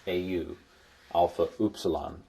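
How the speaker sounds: ordinary talk, nothing marked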